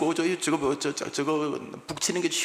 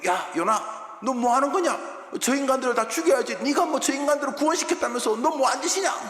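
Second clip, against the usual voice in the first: mock scolding; 12 semitones higher, 4 dB louder than usual. Hometown people scolding Jonah.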